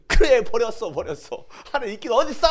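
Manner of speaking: loud, high exasperated cry; bitter outburst. Retold or imitated. Believer answering the mockers, loud bitter outburst. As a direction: burst out as if giving up on God. Voices embittered believer giving in.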